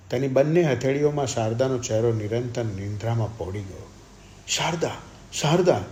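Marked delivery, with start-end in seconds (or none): 4.5-5.8 high cries, second one louder; shouting. A narrator's reading. Brother shouts at his dying sister, desperate shouting. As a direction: shout her name over and over. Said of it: "તેણે દૂમ બૂમ પાડી".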